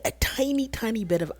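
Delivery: high, small, soft voice; tiny-bit emphasis. Real, not acted. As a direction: shrink the voice right down. Pleading for just a tiny bit.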